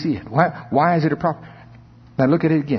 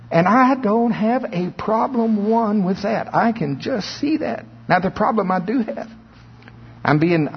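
preacher's voice lifted much higher, pressing the point; heightened preaching; 8 semitones higher than usual. Acted. Preacher stirring the congregation in a raised, excited voice. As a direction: climb up in pitch like a revival preacher.

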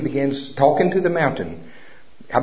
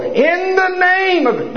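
high, drawn-out preacher yell; mock screaming preacher; 4 dB louder, much slower than usual. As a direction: ham up a shouting faith healer. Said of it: "no, I didn't go like that".

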